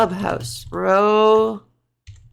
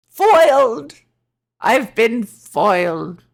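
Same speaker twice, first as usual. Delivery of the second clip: loud, hammy defeated villain; mock villain; 5 dB louder, much slower than usual. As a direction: cry out like a foiled villain.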